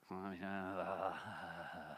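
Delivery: drawn-out hammy yawn; fake yawn. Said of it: "do you see I'm yawning".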